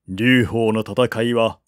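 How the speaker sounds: ordinary delivery, nothing marked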